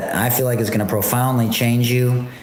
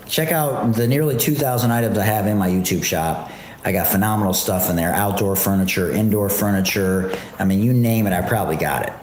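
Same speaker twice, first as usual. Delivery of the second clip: normal talking voice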